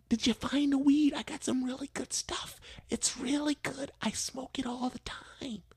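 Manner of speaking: high, hushed conspiratorial granny fading to a whisper; old lady voice. Retold or imitated. Old lady bragging to the worker about her weed, high hushed voice. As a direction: tempt him softly like a secret.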